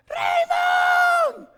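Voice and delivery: high, loud, drawn-out holler; screaming mother's voice, Spanish pronunciation; mom's very loud call. Retold or imitated. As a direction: holler for a child like a mom calling across the woods. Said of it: "His mom's very loud voice".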